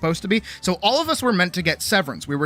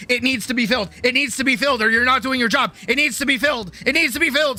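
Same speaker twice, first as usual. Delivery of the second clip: building panic; 6 semitones higher, faster than usual. Retold or imitated. Retail trauma, compulsive refilling.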